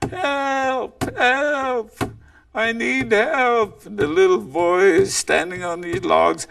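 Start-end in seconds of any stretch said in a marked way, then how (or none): 0.1-3.9 mock cry for help. Coyote in the brush fakes distress to lure the boy, high mock yell. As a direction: call out as if pretending to need rescue. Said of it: "he starts yelling".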